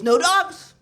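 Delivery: high, loud bark of no; landlord shout. Retold or imitated. Landlord yelling at tenant, loud shout. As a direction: yell it like an angry landlord.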